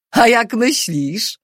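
slow, high-pitched retort; testy, slowed comeback; irritated guard. A narrator's reading. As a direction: throw the question back, annoyed and drawn out. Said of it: "zirytował się jeszcze inny gwardzista".

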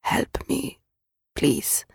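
breathless whispered plea, no voice at all; faint whisper. A narrator's reading. Injured woman in the rubble whispers for help. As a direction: barely breathe the words out, dying. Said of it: "mogła jedynie wyszeptać".

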